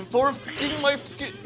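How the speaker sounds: usual speaking voice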